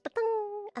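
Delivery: high "Butong", drawn out; playful high sound-effect call; question-mark sound effect. Shown as a demonstration. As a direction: voice a cartoon question mark popping up, high-pitched. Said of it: "that's the, the question mark".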